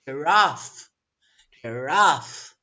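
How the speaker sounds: "giraffe" with a loud, long "raffe"; weak "gi", then push "raffe" out loud; second-syllable stress. Shown as a demonstration. Teacher modelling the right answer, stress on the end. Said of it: "which syllable is stressed?".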